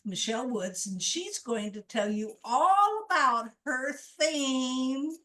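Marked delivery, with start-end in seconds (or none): 2.4-5.1 loud, drawn-out introduction, pitched up; announcer's call-up. Real, not acted. Host calls Michelle up to share, drawn-out announcer's call. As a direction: stretch the last words out to bring her on stage.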